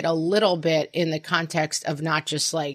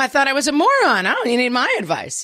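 high, sing-song sarcastic surprise; mock gloating; 7 semitones higher, 3 dB louder, faster than usual. Parent taunting kid who now wants advice, mock gloating. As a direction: feign wide-eyed surprise to rub it in.

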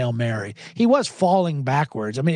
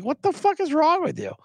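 mock coach yell; 11 semitones higher than usual. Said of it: "he just yells at Russell Wilson".

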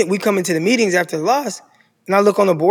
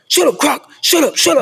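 imitating a coach snapping shut up, pitched higher; coach telling him off; 9 semitones higher, 3 dB louder than usual. Coach shushing the senior captain in the meeting, higher mock scolding. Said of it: "another coach was like".

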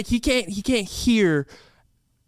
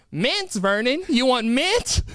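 grandma repeating herself; 6 semitones higher, slower than usual. Acted. Grandma repeats for deaf husband.

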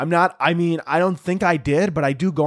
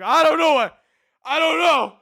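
high, frantic, close to crying; mock panicked pleading to the TSA guy; near-tears panic; 9 semitones higher than usual. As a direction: plead like you're about to break down. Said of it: "I almost started, like, crying".

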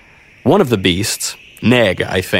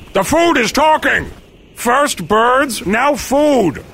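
very high, exclaiming beast voice; high-pitched incredulous creature; astonished yeti; 12 semitones higher than usual. Acted. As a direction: squawk in outraged wonder, pitched way up. Yeti exclaims at talking food.

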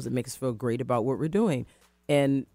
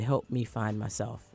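soft slow plea; 5 semitones lower, 4 dB quieter, slower than usual. Retold or imitated. Plea to younger self.

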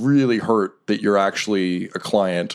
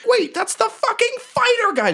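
high, put-on outraged voice; indignant boss. Brothel owner spotting the fighter, indignant. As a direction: spit it out in disbelief.

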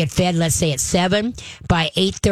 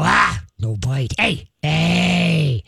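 low, slow, stern no bite; deep growling correction; much slower than usual. Shown as a demonstration. Trainer demonstrating to owner how to correct nipping, deep and slow. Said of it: "get a deep growl and go".

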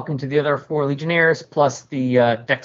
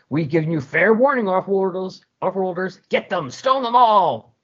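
mob leader ordering; 7 semitones higher than usual. Acted. Parents ordering stoning.

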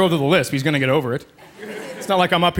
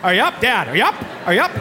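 put-on kid waking dad; 5 semitones higher than usual. Kid voice then dad reply.

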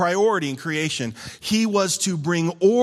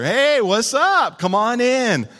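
cheery welcome; 6 semitones higher, 5 dB louder, slower than usual. Acted. Acting the host answering Jesus.

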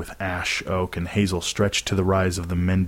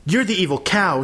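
high-pitched furious retort; angry shout; 11 semitones higher than usual. A narrator's reading. Girl shouting back at the teacher, angry. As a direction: hurl the insult back, voice leaping up. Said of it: "she shouted at Missus Wilson".

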